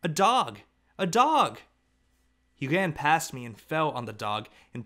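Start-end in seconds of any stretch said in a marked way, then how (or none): 0.0-1.6 put-on high, loud child's delighted cry; loud, much higher happy shout; excited hollering. A narrator's reading. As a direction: holler it like a thrilled little boy. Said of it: "He was hollering".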